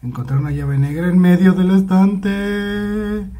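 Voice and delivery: loud, high, steadily rising call. Real, not acted. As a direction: sing out the discovery, climbing to the end. Announces a found key.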